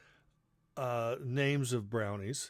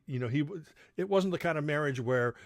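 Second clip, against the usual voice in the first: usual speaking voice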